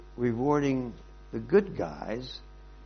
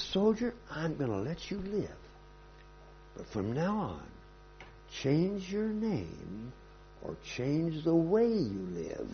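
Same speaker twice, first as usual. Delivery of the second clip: softer, slow, solemn sentence; grave mercy; 4 semitones higher, 8 dB quieter, slower than usual. Acted. Alexander sparing the soldier, soft, slow and solemn.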